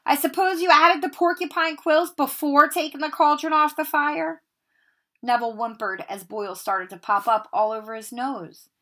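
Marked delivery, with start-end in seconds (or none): 0.0-4.4 higher, louder scornful question; sarcastic Snape. Acted. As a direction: sneer at the boy's mistake. Snape scolds Neville.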